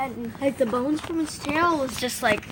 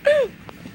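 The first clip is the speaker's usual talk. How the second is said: mocking laugh; 14 semitones higher, 4 dB louder than usual.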